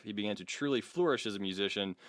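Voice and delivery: ordinary talk, nothing marked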